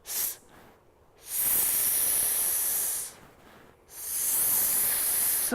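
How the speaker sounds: long voiceless "S" hiss; S sound. Shown as a demonstration. Practising the sound with puppet, long hissed "S". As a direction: sustain "S" behind the teeth, no vowel. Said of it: "let's just get that S sound".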